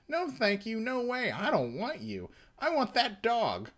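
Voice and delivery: voicing Darby. S0 voices Darby refusing.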